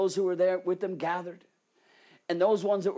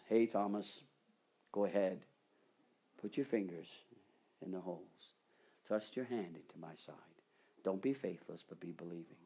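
low and soft, tender; Jesus inviting Thomas, tender and soft; gentle invitation; 9 semitones lower, 8 dB quieter than usual. Acted. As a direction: coax him kindly, almost hushed.

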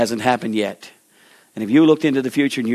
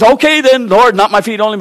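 much higher and louder, all in; eager burst; 9 semitones higher, 8 dB louder than usual. Acted. Peter suddenly begs Jesus to wash him all, eager and loud.

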